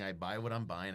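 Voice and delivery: usual speaking voice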